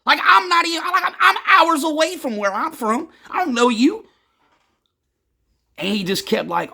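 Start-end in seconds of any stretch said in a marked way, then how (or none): 0.0-4.1 much higher, louder indignant protest; confrontational. Retold or imitated. Telling a stranger not to label him, heated confrontational retelling. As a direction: push back hard, pitch shooting up. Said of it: "kinda confrontational".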